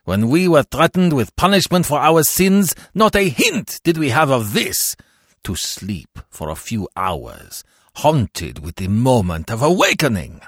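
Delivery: rising desperate plea of the damned; wailing lament. A narrator's reading. Jean-Marie cries out against purgatory, building to wails. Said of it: "cried the voice of Jean-Marie".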